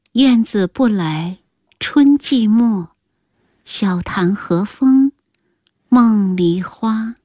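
slow, measured poem chanting with drawn-out endings; thin-voiced recitation. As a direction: recite verse slowly, lingering on each phrase. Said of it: "听那个人细声吟道". (细声慢吟诗句)

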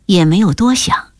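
ordinary delivery, nothing marked

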